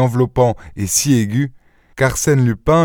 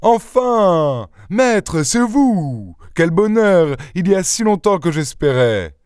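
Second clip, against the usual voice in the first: gushing, high-pitched welcome; effusive host, pitched well above usual; exuberant; 8 semitones higher than usual. A narrator's reading. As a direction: greet an idol with overflowing delight. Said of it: "s'écria plein d'exubérance".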